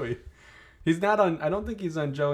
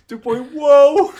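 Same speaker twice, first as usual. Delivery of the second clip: drawn-out high loud whoa; Joey Lawrence whoa; 13 semitones higher, 16 dB louder, much slower than usual. Pretend. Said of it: "wasn't [name] doing an impression".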